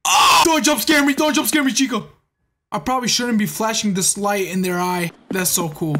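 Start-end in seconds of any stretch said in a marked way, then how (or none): 0.0-2.1 startled yelp, then pleading; scared horror game streamer. Real, not acted. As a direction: shout in real fright.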